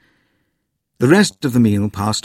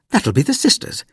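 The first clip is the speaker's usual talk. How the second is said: higher, cheerful mother; bright; 13 semitones higher than usual. A narrator's reading. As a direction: answer lightly and cheerfully. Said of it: "said Mother brightly".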